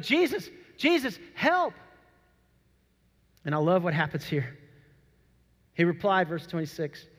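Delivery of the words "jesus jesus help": higher mock panicked call for help; panicked cry for help. Disciples calling Jesus in the storm, higher mock panic. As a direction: plead like you're going under. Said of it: "They're like".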